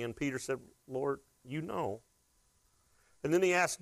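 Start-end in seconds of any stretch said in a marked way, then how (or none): 0.9-2.0 slow, softer, hesitant Lord you know; ashamed reply. Acted. Peter answers Jesus, hushed and ashamed. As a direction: barely get it out, ashamed.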